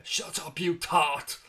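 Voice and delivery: a rough attempt at a Phil Mitchell cockney accent; mock cockney hard man Phil Mitchell accent, half-whispered start; Phil Mitchell impression. Streamer reading chat does a Phil Mitchell cockney accent. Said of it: "my attempt at a Phil Mitchell accent".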